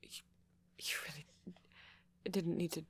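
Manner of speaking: hesitant, stammering speech with long pauses; shy, flustered date. Acted. Flustered host welcoming a date to movie night, shy and halting. Acted shy roleplay, then plain outro.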